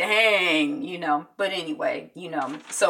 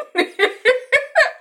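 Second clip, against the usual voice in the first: high, bubbly giggle; real high-pitched laugh; amused giggle; 8 dB louder than usual. Real, not acted. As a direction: giggle at your own confusion. Amused by her own confusion.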